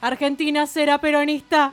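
high, loud balcony speech; Evita. Acted. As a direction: orate to the crowd from a balcony. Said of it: "Me vestí de Evita".

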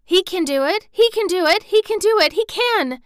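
high, loud, breathless-sounding repetition; frantic rising insistence; urgent gabbling. A narrator's reading. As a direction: chant it feverishly, willing it true. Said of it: "she gabbled over to herself under her breath as fast as ever she could".

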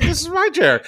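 high, soft whiny "my chair"; mock friend's protest. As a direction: whine it like a possessive friend. Friend's voice, possessive.

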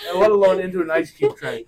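high, loud, crying laughter; disgusted laughter. Real, not acted. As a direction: laugh till it cracks. Both crack up laughing.

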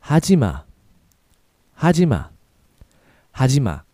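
now say it at near normal speed. Shown as a demonstration. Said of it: "So a little faster".

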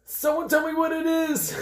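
high desperate plea; pleading. Real, not acted. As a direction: beg the table for answers. Player pleading loudly in game.

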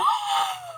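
sharp gasp. Real, not acted. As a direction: gasp in delighted shock. Hearing a shocking ship idea.

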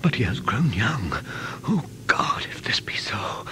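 breathless, voiceless whisper of dread; hushed terror, barely a breath; frightened whisper. Acted. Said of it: "Jonathan whispered".

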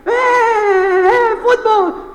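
high, loud stadium cheer; long high-pitched crowd roar; imitated football fan yell. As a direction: scream it like a fan in the stands. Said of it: "¿gritan ahí?".

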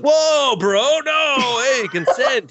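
loud high squeal of friends objecting; alarmed. Retold or imitated. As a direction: stop him in shock. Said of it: "They're like".

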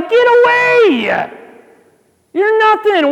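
high, slow booming command; God yelling down. Pretend. God yelling down at the giant, high and slow. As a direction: roar it down from heaven, dismissive. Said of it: "have God yell down".

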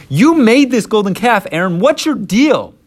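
loud, high-pitched confrontation; exasperated Moses. Acted. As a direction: demand an explanation, voice way up.

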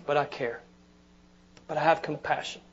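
quiet sincere compassion; tender and quiet. Pretend. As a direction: say it gently, almost to yourself. Soft aftermath of sigh.

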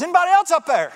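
very high, loud shout upward; hammed-up comic panic; desperate holler. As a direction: holler for somebody else up there. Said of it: "Man hollers".

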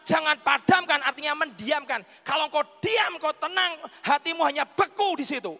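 loud, high, urgent exhortation; full-force preaching. Real, not acted. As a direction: drive the warning home to the congregation.

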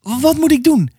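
high, desperate plea; desperate cry. Acted. Mother begging the pastor for help, high and desperate. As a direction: cry out in despair. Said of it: "riep ze wanhopig".

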